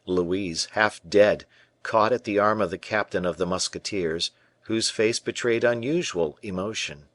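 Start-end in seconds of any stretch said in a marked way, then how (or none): none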